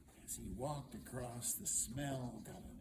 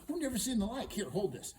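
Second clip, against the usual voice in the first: high, loud "I've never seen the like"; brisk exclamation; 9 semitones higher, 8 dB louder than usual. Acted. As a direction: toss it out high and busy. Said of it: "she goes".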